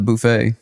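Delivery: "buffet" said as boo-FAY; "buffet" with an OO in the first syllable; alternative pronunciation. Shown as a demonstration. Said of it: "some people pronounce it buffet".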